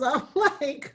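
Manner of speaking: ordinary delivery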